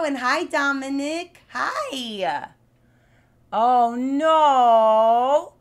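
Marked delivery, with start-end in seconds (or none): 3.5-5.5 mock dismay. Hears a child is sick.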